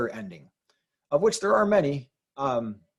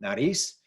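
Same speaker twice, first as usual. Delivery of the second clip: Nariz with the stress on -riz; Spanish Nariz, leaning on the final syllable; stress on last syllable; 3 semitones lower than usual. Shown as a demonstration. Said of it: "should be on the last syllable".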